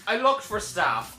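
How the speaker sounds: usual speaking voice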